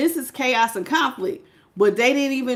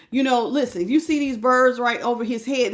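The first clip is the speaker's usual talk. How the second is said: ordinary delivery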